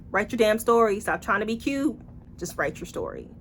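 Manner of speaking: usual speaking voice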